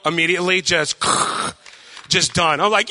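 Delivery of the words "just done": ordinary delivery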